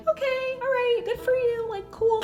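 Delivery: high, soft fake politeness; fake polite. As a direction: chirp it back sweetly and high. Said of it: "being respectful".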